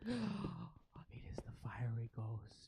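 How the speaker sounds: slow, hushed creature whisper; awed whisper. Acted. Ratling beholding the fiery ghost, slow awed whisper. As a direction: whisper it slowly in awe.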